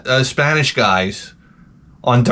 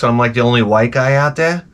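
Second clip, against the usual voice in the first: ordinary talk, nothing marked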